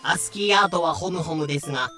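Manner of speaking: ordinary delivery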